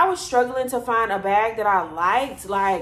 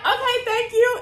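squeaky high, overly polite thanks; high polite thank you; 4 dB louder than usual. Retold or imitated. Thanking the stranger for the wine, squeaky sweet high voice. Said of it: "we like".

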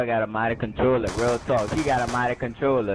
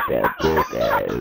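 slow motion voice; 13 semitones lower, 4 dB louder, much slower than usual. Pretend. Continuing slow motion bit.

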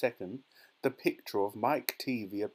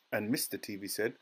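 usual speaking voice

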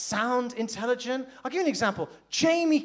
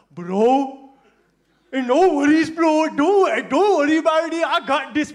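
loud, higher confident bro voice; brash and sure of himself, loud; cocky friend; 5 semitones higher, 9 dB louder, slower than usual. Retold or imitated. Friend Amir reassures him he has it handled, loud and cocky.

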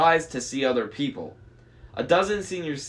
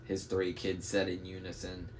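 usual speaking voice

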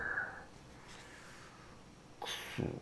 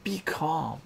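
mother yelling; 9 semitones higher, 8 dB louder than usual. Acted. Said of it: "the mother yells".

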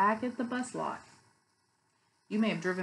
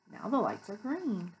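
usual speaking voice

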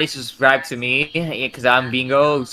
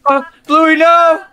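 high, loud alarmed cry; panicked scream; 12 semitones higher, 6 dB louder, much slower than usual. Real, not acted. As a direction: shout in panic for your sister. Sister caught by grandpa in game.

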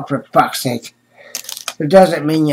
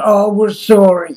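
higher, louder fake-sorry receptionist; mock apology; 5 semitones higher, 4 dB louder than usual. Vlogger mocks the doctor's office turning away a patient, insincere.

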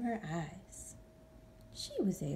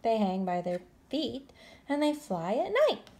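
rising, louder enthusiasm; excited explaining; 6 semitones higher, 7 dB louder than usual. Acted. Stellaluna telling the birds about bats, building. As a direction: build up the wonders. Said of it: "Stellaluna explained".

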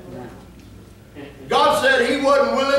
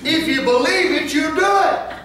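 preached line; 6 semitones higher than usual. Shown as a demonstration. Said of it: "Do I need to say that again?".

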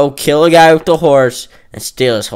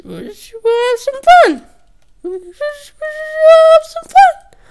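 very high, slow sing-song taunt; drawn-out falsetto singsong; 4 dB louder than usual. Pretend. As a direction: tease in a squeaky falsetto. Falsetto taunt while gaming.

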